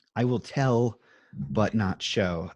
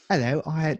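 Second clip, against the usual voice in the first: put-on failed British accent; 8 semitones higher, 4 dB louder than usual. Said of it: "that's all wrong".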